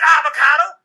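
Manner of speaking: high, loud outburst; acted wife's indignant yell; exasperated answer. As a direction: blurt it as the maddeningly obvious answer. Wife's exasperated punchline in quarrel.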